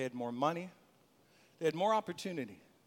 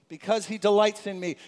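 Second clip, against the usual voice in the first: congregation call; 6 dB louder than usual. Shown as a demonstration. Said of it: "I want us to say this one".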